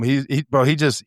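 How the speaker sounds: normal talking voice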